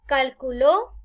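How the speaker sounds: Calculó as cal-cu-LÓ, loud; stress on last syllable. Shown as a demonstration. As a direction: land hard on the final LÓ of Calculó. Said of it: "Y acá hay otro ejemplo".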